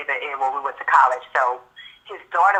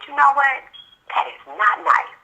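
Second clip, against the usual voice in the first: higher-pitched, measured telling-off; retold scolding; 10 semitones higher than usual. Stepmother to child who called her ugly, gently scolding. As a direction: correct a child firmly, pitched up.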